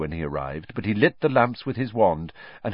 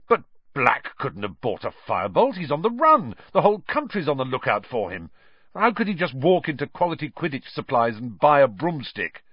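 higher-pitched disbelieving protest; incredulous; 6 semitones higher than usual. A narrator's reading. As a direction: argue it as if it's absurd. Wood argues in disbelief.

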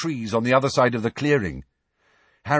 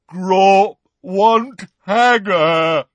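very slow, loud, raised bellow; roaring giant; 8 semitones higher, 9 dB louder, much slower than usual. A narrator's reading. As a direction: bellow word by word like a demanding giant. Roaring giant demands Hagrid.

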